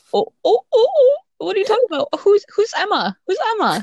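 put-on high-pitched innocent act; feigned ignorance. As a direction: play dumb in a squeaky high voice. Acts out feigning ignorance of crush.

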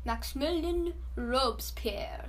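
Maximilien Robespierre in a clumsy mock French accent; self-mocking French accent attempt; bad French accent. Shown as a demonstration. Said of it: "I have a terrible French accent".

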